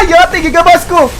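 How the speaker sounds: high-pitched mock wail; high, exclaimed game-death complaint; hammed-up outcry. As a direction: cry out as if cut down in battle. Cries out at being cut down.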